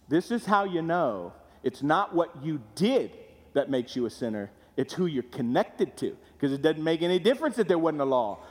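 ordinary delivery

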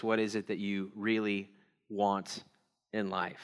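low, quiet, with pauses before the last words; hushed, weighty question with gaps; soft, grave question. Real, not acted. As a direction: pose it softly and let it sink in. Question posed, then repeated for weight.

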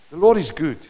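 usual speaking voice

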